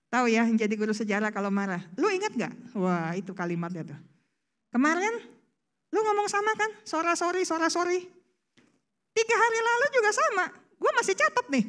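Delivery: high, fast nagging list of past wrongs; nagging history teacher. Retold or imitated. Preacher mimics a spouse who keeps dredging up the past. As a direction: rattle off old grievances like a history teacher. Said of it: "menjadi guru sejarah".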